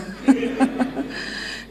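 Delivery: soft unvoiced laugh; a real quiet laugh at her own cheeky answer; breathy laugh. Real, not acted. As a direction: let out a quiet, airy laugh.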